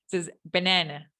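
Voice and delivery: banana with relaxed schwa vowels, like benena. Shown as a demonstration. Teacher modelling English banana for students. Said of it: "Fíjense, ¿ves?".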